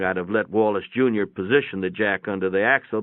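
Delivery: ordinary delivery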